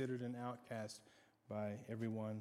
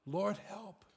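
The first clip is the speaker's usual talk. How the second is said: crying out; 8 semitones higher than usual. Retold or imitated. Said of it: "they cried".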